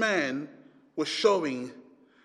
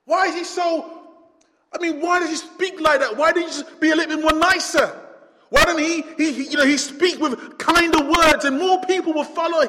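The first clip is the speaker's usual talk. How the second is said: high, loud, exasperated complaining; mock indignant question; 10 semitones higher, 8 dB louder than usual. Pastor voicing a doubter's loud complaint. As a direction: shout out the doubter's protest. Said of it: "And you think to yourself".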